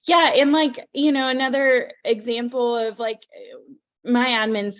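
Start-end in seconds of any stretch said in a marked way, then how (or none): none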